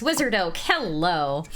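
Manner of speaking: ordinary talk, nothing marked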